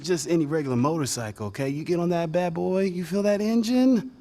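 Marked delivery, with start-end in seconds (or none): none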